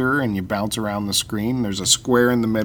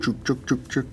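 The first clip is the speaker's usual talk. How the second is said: train chugging; 6 dB quieter than usual. Pretend. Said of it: "That's the excuse train".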